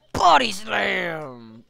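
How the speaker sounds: slow drawn-out body slam; loud wrestling call. Pretend. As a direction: call it like a ring announcer. Announcing wrestling move.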